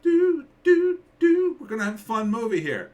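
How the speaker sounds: high, loud sing-song mockery; mock cheerful. Mocking the trailer's fun opening, high sing-song. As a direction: sing it like a goofy upbeat jingle. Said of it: "it felt like".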